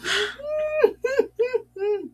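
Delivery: laughing till it squeaks; a high wheezing laugh near tears; high crying laugh. Real, not acted.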